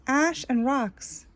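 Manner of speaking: plain reading voice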